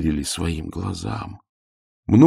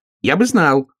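high, louder little hedgehog voice; pitched-up hedgehog protest; offended hedgehog; 10 semitones higher, 5 dB louder than usual. A narrator's reading. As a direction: insist in a small high voice. Offended hedgehog argues with bear.

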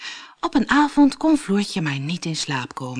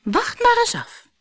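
put-on high, knowing girl's voice; a little girl daring him to wait and see; confident girl. A narrator's reading. Floortje to teasing Keesje, high and sure of herself. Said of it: "zei Floortje".

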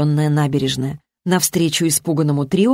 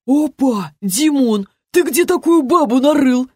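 high-pitched clownish jeer; jeering drunk pal; 8 semitones higher than usual. A narrator's reading. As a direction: tease a mate in a high lout's voice. Drunk pal joins the mockery. (высокий глумливый голос)

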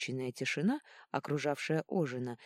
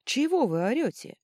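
yelled retort; 10 semitones higher, 9 dB louder than usual. A narrator's reading. Healer yells back at shouting women.

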